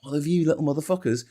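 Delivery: ordinary talk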